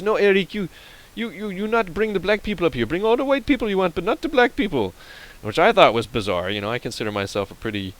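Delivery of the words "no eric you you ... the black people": high, loud imitation of a scolding Jamaican woman; mock-screaming Jamaican accent, pitched way up. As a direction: mimic an upset Jamaican housekeeper telling someone off. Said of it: "like, screaming at them".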